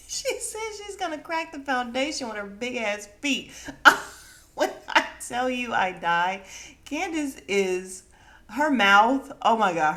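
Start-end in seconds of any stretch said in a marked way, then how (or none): 3.6-4.2 loud burst of laughter. Real, not acted. Host cracking up at the feet joke, loud laugh. 8.5-9.9 amazed. Real, not acted. Reacting to Candace's insult.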